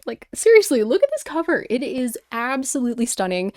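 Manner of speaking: gushing, bright and loud; excited. Real, not acted. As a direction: show the cover off proudly. Showing off her book cover.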